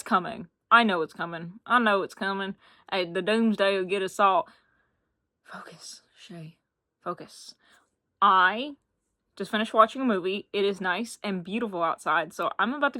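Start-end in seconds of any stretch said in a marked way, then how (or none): 5.4-7.5 hushed self-reminder to focus; whispering to self. Real, not acted. Vlogger whispering to herself to get back on topic. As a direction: whisper it, pulling yourself back. Scolding herself to stay on track.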